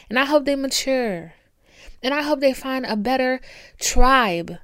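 worked-up, high-pitched tirade; impassioned rant. Real, not acted. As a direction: punch out each wish with conviction. Impassioned rant wishing haters would heal.

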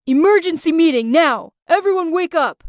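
loud, urgent shout through the house. Acted. Angry friend waking the housemates, shouting loudly. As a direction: rouse the whole house at full voice. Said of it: "he shouted at the top of his voice".

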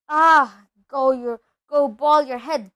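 loud, impatient brush-off; dismissive. Acted. Hagrid tells both Dursleys off, loudly dismissive. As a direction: wave them away with a shout.

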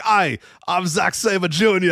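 slow, high-pitched bad English accent, Scottish-tinged; terrible English accent. Co-host imitates a wrestler's promo in a terrible English accent. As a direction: put on an Australian's botched English accent. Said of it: "it's like a terrible voice".

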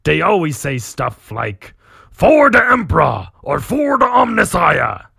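loud, high orc mimicking battle cries. Orc captain mimics his enemies' chants, orc shout. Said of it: "you read an orc talking".

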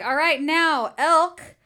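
loud, drawn-out fight announcer call; announcer guy. Acted. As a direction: announce the matchup slowly, building to the last name. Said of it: "the announcer guy comes up and he's like".

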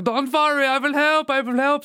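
high, bright mock German accent. Joke teller voicing the German swimmer, hammed-up German accent. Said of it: "It's a German fella".

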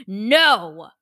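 a loud, abrupt NO; one loud word of refusal, thrown at someone; retold yell. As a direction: imitate a customer yelling no. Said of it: "yells it at me".

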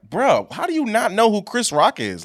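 retold outrage at a friend, half laughing; incredulous exclaim. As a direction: friends exclaiming in disbelief at Jordan, high and loud. Friends scold clueless boy Jordan.

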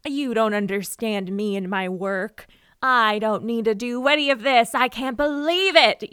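hammed-up rant, building to loud; acted indignant outburst. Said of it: "would read the notes and go".

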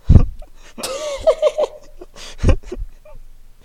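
high, loud helpless laugh breaking near tears; burst of laughter. Real, not acted. Laughing at the ten-out-of-ten review, a helpless high cackle.

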